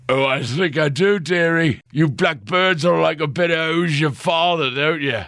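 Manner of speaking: put-on high, leering drunken man; leering drunk reporter voice. A narrator's reading. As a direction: slur it like a boozy hack. Reporter taunts Tammy.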